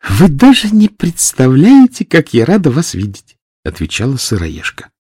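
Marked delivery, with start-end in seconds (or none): 0.1-3.4 put-on high sweet girlish voice; breathy cutesy giggly. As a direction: gush with delight. Sweet mushroom greets hedgehog.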